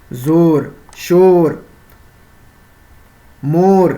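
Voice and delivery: زور، شور، مور with o, not oo: "zor, shor, mor"; majhool o vowel. Shown as a demonstration. Teacher listing waw majhool examples, o sound in زور، شور، مور. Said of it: "کھینچ کے نہیں پڑھ رہے ہیں".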